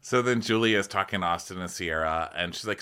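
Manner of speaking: ordinary talk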